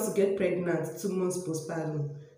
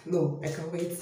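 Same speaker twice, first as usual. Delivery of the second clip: ordinary talk, nothing marked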